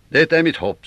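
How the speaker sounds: mycket högre, högljudd och innerlig; innerligt hopp. Acted. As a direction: uttryck ditt hopp starkt. Toke uttrycker hopp. (högre, innerlig hoppfull röst)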